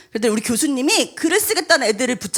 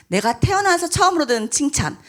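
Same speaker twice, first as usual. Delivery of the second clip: ordinary talk, nothing marked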